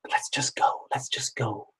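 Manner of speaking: hushed, whispered suggestion to leave; imitating a whispered aside; whispered urging. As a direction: whisper it discreetly to a partner. Girlfriend urges a discreet exit.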